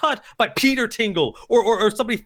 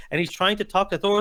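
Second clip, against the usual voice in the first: normal talking voice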